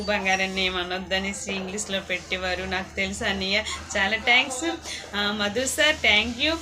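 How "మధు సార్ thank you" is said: usual speaking voice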